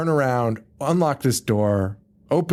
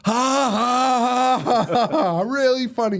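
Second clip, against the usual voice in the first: mock forced laugh; 9 semitones higher, slower than usual. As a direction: fake ha-ha climbing high. Acts Kit's reaction to the prank.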